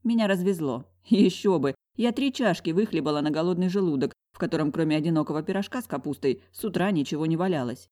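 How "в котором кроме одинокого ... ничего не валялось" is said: ordinary delivery, nothing marked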